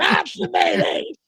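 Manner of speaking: slow, strained mock scream of an old woman; screaming old lady. The lady in the wheelchair overrules her son, screaming slowly. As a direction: demand it like a sick old lady in the corner. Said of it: "that voice in the corner of Subway screaming".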